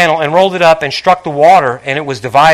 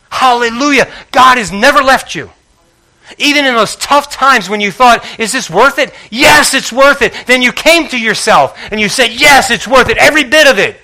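impassioned preaching; 7 semitones higher than usual. Acted. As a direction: lift them with each line. Preaching at full force.

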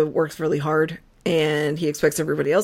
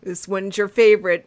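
ordinary talk, nothing marked

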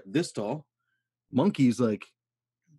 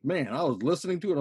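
ordinary talk, nothing marked

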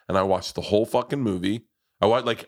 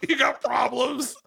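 laughing; 13 semitones higher than usual. Real, not acted. Mocking 3D-movie criers, laughing high. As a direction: laugh the words out.

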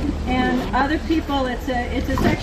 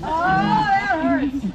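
slow, higher mock wince; drawn-out mock hurt; 6 semitones higher, much slower than usual. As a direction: stretch it out like it really stings. Mock pain repeated, drawn out.

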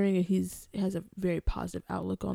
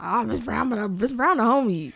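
playful student greeting; 4 semitones higher, 6 dB louder than usual. Retold or imitated. Student greeting teacher Miss Brown with playful familiarity. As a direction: mock-friendly call to the teacher, louder.

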